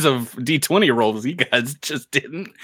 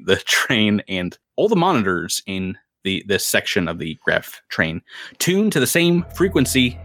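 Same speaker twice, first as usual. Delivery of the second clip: ordinary delivery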